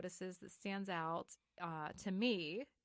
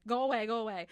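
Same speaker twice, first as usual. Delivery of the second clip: louder, higher, hurried dismissal; urgent brush-off; 4 semitones higher, 11 dB louder, faster than usual. Retold or imitated. Lark shooing someone away, loud brush-off. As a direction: shoo them away loudly.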